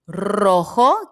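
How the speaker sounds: Rojo with a rolled R and a guttural J; loud, clear Rojo; trilled R, throaty J. Shown as a demonstration. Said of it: "Ro, vibrante".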